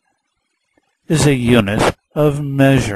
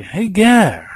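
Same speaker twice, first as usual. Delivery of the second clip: student voice; 8 semitones higher than usual. Retold or imitated. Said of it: "So you say".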